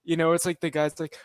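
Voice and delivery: normal talking voice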